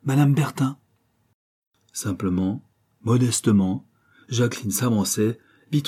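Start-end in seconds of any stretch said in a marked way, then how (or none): 0.0-0.8 maid announcing. Acted. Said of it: "une femme de chambre qui annonça".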